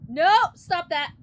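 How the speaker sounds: loud exasperated protest. Real, not acted. Reacting to rain again.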